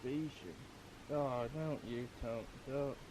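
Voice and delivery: hushed whisper so fish aren't spooked. Real, not acted. Whispering while fishing at night.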